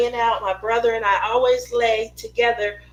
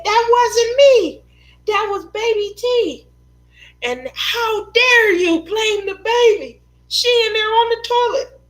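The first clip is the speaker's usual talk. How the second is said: put-on high, loud, wailing child's protest; brother's protest; 11 semitones higher, 5 dB louder than usual. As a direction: whine it like a little boy blaming the baby. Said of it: "And he said".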